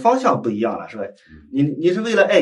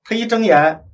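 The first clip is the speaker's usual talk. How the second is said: ordinary delivery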